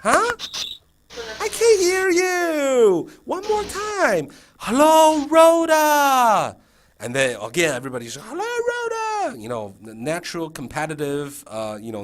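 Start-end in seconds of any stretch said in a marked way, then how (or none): 0.0-6.6 high, loud, stretched-out playful demand; pretending not to hear. Teacher asking kids for one more time, playful mock deafness. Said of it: "I'm gonna pretend it's like". 8.3-9.4 imitated kids, very high squealed greeting; chorus it high like eager kindergarteners; competitive higher voice. Class competing to outdo the teacher, high squeal. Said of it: "higher than yours".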